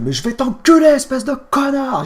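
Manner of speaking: high, loud re-enacted outburst; enraged insult. Cat owner reliving his insult at the dog owner, enraged shout. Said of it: "j'étais bien énervé".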